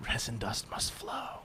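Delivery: breathy whispered intone. Pretend. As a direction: whisper it like a prophecy. Joke whisper, Dune quote.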